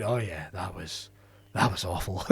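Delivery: low, quiet, slow, deflated; a deflated comic owning up, low and quiet; glum admission. Retold or imitated. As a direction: mutter it, beaten.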